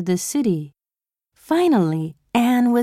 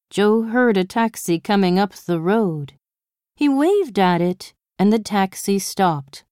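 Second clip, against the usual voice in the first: ordinary delivery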